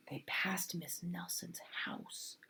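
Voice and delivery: soft hushed whisper; suspense whisper. A narrator's reading. Reader building suspense for the child, whispered. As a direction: breathe it like a secret.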